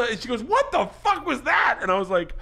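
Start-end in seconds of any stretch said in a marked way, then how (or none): 0.4-1.8 imitated shocked outburst. Comedian acts out his startled wife's question, high and shocked. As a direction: mimic a stunned wife, pitched way up.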